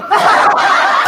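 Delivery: a big high cackle bursting out; sudden howl of laughter; loud burst of laughter. Real, not acted. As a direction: laugh out loud, caught off guard. Reacts to friend's joke.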